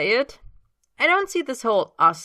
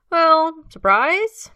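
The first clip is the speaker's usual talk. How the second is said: playful reveal; 12 semitones higher, 11 dB louder, much slower than usual. Acted. Mitch announcing playfully.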